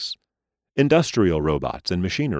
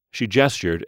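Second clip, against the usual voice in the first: usual speaking voice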